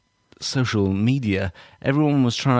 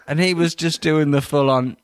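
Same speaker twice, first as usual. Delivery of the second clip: ordinary talk, nothing marked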